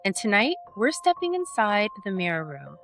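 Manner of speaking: podcast intro read. Acted. Host opening her horror podcast for listeners, smooth announcer read. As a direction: present the show like a radio host, warm and measured.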